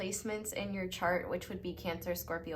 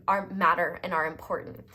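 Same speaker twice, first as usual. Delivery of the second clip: normal talking voice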